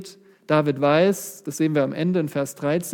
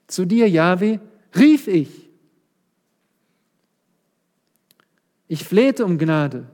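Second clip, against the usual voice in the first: crying out; 3 semitones higher, 5 dB louder, slower than usual. Acted. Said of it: "Was hat David gemacht?".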